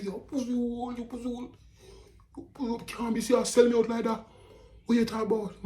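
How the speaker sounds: high, furious retold yelling at the worker; boss flaring up. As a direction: blow up at the worker, pitch way up. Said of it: "The boss start flare up".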